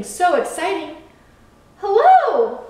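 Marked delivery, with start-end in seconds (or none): 1.8-2.6 loud, high hello called across; calling out. A narrator's reading. Lighthouse hailing the speedboat, calling out. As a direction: call out eagerly to someone far off. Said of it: "called to the boat".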